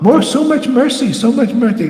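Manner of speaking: high mock boast of getting mercy; mocking squeaky voice. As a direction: squeak it out like a silly follower. Mocking greedy followers.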